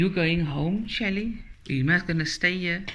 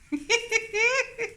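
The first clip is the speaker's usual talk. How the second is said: high laugh. Real, not acted. Opening laugh.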